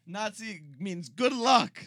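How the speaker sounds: slow, loud fake German; mock German accent. Co-host explains as the party, mock German accent. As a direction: spell it out in a hammed-up German accent. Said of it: "Give me your best German accent".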